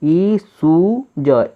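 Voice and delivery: ई, सू, ज each drawn out slowly; teacher sounding out syllables; slow syllable blending. Shown as a demonstration. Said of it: "इतना तो हमारा बन गया ना".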